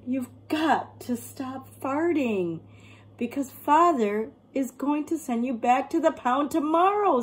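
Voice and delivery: lightly voiced child begging the dog. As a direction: plead like a worried kid. Child warns Walter of the pound.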